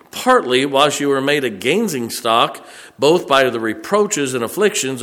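loud, ringing King James reading; preaching scripture reading. Acted. Preacher reading Hebrews to his church, loud preaching cadence. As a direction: proclaim the verses to the whole room.

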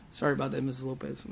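quick, soft apology through laughter; laughing apology. Real, not acted. Preacher apologizing to Missus Lopez, laughing softly.

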